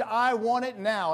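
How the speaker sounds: put-on loud, slow spoiled-child whine; screamed demand. Preacher quoting Veruca Salt screaming at her father, loud and slow. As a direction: demand it like a spoiled rich girl. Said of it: "She screamed".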